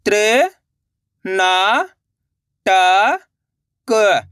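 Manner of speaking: त्र, ना, टा, क held separately with long gaps; syllable by syllable. Shown as a demonstration. Teacher breaking a long word for learners, syllable by syllable. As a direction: sound out त्र, ना, टा, क one by one. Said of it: "एक अक्षर का उच्चारण करके".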